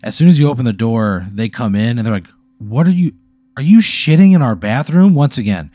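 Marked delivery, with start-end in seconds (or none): 2.6-5.1 higher, louder mock outrage; lightly voiced scandalized twins; indignant twins. Twins catching you in their bathroom, mock indignant. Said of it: "in perfect unison".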